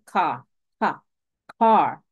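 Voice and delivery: "Ka, ka, car" split into its first sound. Shown as a demonstration. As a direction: tap out the hard k of "car" before the whole word. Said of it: "Car tiene sonido inicial de consonante".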